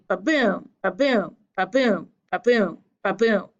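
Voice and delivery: five iambic beats, stress landing on each "boom". Shown as a demonstration. Teacher sounding out the meter for a class, iambic beat. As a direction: chant "ba-boom" with a light "ba" and strong "boom". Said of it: "The accent goes on the second syllable".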